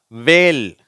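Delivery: வேல் loud, starting on w; teacher's emphatic வேல், h silent; silent H demo. Shown as a demonstration. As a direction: model WHALE with no h. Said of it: "இங்க எச் silent ஆயிடுச்சு".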